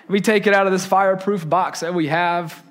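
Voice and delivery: usual speaking voice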